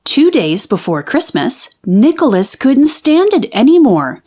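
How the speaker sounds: ordinary delivery, nothing marked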